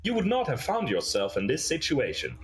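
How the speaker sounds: high, singsong robot voice; stilted company pitch with swooping intonation; robot recruiter. Acted. Robot recruits a captured guard, stilted and high.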